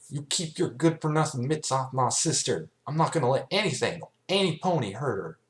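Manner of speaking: high, loud, fast furious warning; angry protective shout. Acted. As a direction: snarl it out to protect her sister. Protective sister confronts creature.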